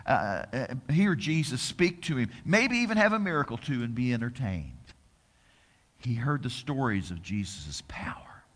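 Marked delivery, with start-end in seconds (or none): 6.0-8.4 hushed whisper. Real, not acted. Preacher telling what Pilate heard, whispered. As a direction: lean in and whisper it. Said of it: "whispered storytelling".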